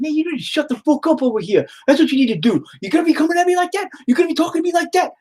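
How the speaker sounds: high-pitched, fast New York Italian rant; Italian-American wife. Retold or imitated. Wife mad at her teasing husband, mock Italian-American accent. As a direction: imitate a riled Italian-American wife telling you off. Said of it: "she starts becoming more Italian".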